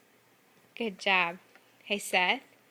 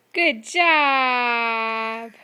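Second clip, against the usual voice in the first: excited praise; 12 dB louder than usual. Real, not acted. Mother cheering her toddler for fitting the piece, loud excited praise.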